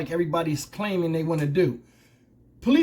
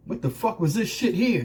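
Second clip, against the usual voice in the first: ordinary delivery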